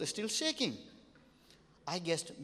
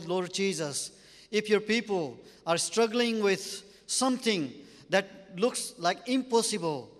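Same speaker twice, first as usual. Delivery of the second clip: fervent prayer; 5 dB louder than usual. Acted. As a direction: pray over the congregation with full fervour. Leads a congregation in prayer.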